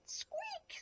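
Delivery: very high, soft little squeak; tiny squeak. A narrator's reading. Little Lion tries to roar, tiny high squeak. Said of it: "said Little Lion".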